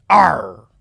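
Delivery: short growling arr; gruff bark. Acted. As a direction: bark it out like a pirate. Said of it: "I'm barking like that".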